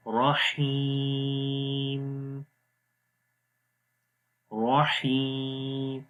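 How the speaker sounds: slow رحیم, long 'ee' closing on m; stopped ending. Shown as a demonstration. Teacher showing how to stop, رحیم held long. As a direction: stretch رحیم and stop on a vowelless m. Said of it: "اس لیے اس کو پڑھیں گے".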